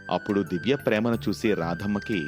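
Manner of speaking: usual speaking voice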